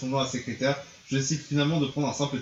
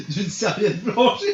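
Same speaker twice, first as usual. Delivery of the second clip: higher, louder, slowly, cracking up; can't finish the line without laughing; laughing through it; 6 semitones higher, 6 dB louder, much slower than usual. Real, not acted. Reading the cheesy shower scene, breaking into laughter.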